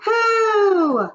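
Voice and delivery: very high loud pooh; disgusted witches. Acted. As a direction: squeal in disgust. Witch chorus.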